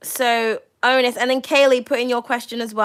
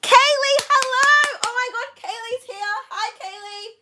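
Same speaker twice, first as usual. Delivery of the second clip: excited greeting; 11 semitones higher than usual. Real, not acted. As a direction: squeal her name, thrilled she came. Regular viewer joins the stream.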